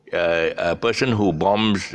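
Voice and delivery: usual speaking voice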